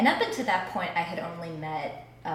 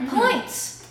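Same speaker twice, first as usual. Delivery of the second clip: high, loud mock-announcement squeal; gleeful one-word cheer; playful exclamation; 5 dB louder than usual. Playful exclamation at another point.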